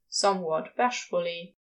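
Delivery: usual speaking voice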